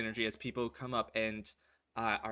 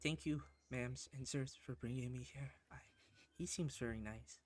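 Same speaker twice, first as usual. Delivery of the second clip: shy thanks; 9 dB quieter than usual. Acted. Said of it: "he just says in his head".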